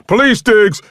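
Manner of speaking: police officer's shouted warning, high and loud; yelling. A narrator's reading. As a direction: bellow an armed order. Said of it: "I heard John yell behind me".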